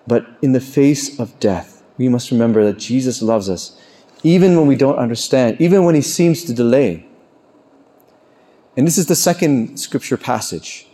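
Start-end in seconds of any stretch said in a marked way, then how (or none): none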